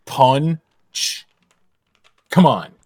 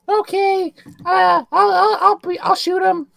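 much higher, cartoon-squeaky voice; 3 dB louder, slower than usual. Threatening to shoot a rival, in a much higher squeaky voice. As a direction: squeak it like a tiny cartoon critter.

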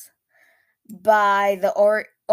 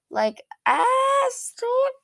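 very high squeal; excited squeal; much slower than usual. Real, not acted. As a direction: shriek it with fangirl joy. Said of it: "I need to stop screaming like that".

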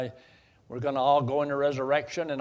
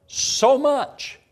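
a sudden, much higher and louder cry of "So much"; fervent exclamation; 10 semitones higher, 7 dB louder than usual. Real, not acted. Preacher assuring the congregation of God's love, loud fervent cry. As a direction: burst out with it as the sermon's peak.

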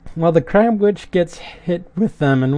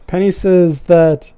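ordinary delivery

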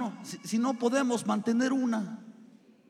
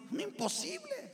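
mock disbelief; 7 dB quieter than usual. Mock disbelief at God's command.

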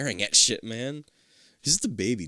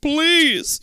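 high, loud plea; begging; 14 semitones higher, 5 dB louder than usual. Acted. Kid begging his parents, high pleading voice. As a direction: beg them to listen. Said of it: "you beg".

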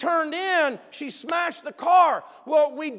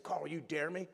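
sneaky classroom dare; 10 semitones lower, 15 dB quieter than usual. Retold or imitated. Classroom dare, hiding from teacher.

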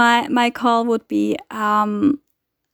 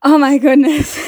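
loud exclamation tumbling into laughter; shaken laughter; 4 semitones higher, 4 dB louder than usual. Real, not acted. Recovering from the cat's jump, laughing. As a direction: laugh off a real fright.

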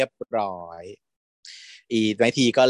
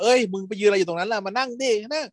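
calling friend over; 8 semitones higher than usual. Retold or imitated. Friend calling others to sit.

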